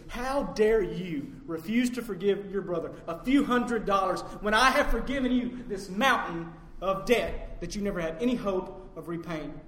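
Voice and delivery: slow, forceful rebuke; indignant master. Acted. Master rebuking the unforgiving servant he pardoned, slow and indignant. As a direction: dress the servant down, leaning on each phrase.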